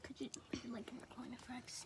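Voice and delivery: quick hushed whisper. Real, not acted. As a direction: whisper it fast so no one hears. Asks quietly for privacy.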